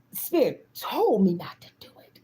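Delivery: whispered confession; hushed, secretive whisper. Real, not acted. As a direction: drop to a whisper as if telling a secret. Confessing spirit's warning.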